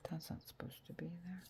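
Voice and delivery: fast, soft whispered aside. Real, not acted. Crafter noticing a stray die cut, whispered aside. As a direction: mutter it under your breath to yourself.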